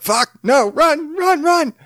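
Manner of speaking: high, loud panicked shriek; panicked yell. Retold or imitated. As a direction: scream it like fleeing in terror. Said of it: "I'm sure half of you are like".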